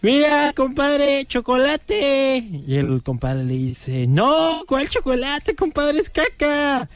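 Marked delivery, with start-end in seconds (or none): 0.0-2.4 put-on high, slow drunk voice; a tipsy buddy pointing something out; high tipsy drawl. 4.1-6.9 put-on high drunk voice; tipsy friend correcting him; high tipsy protest. Second drunk answers.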